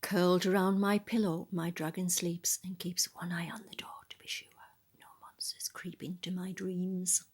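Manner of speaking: ordinary delivery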